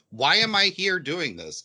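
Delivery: a put-upon character moaning; mock whiny complaint. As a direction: complain like a bored princess. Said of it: "Beast Princess is like".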